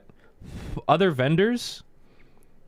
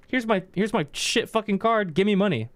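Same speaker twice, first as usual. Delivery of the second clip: put-on higher, pushy kid voice demanding cash; entitled kid; 10 semitones higher than usual. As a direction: shove the card forward and demand money. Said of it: "what they do is they go".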